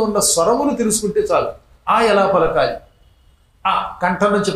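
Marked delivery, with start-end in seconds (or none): none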